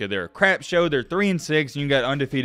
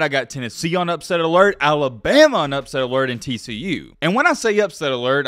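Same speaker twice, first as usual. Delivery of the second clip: usual speaking voice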